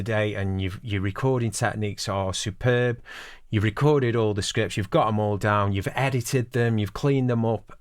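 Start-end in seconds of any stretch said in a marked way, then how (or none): none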